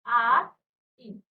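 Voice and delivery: आइन split slowly into आ, then इन; teacher sounding out आइन with a long gap after आ; syllable by syllable. Shown as a demonstration. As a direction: draw out आइन in two separate parts, आ and इन. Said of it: "सबके last में आइन".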